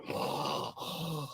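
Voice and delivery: drawn-out, soft mimicked gasps; airy gasps, no voice; imitated gasping. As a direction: imitate a restrained man's heavy breathing. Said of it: "you could hear him going".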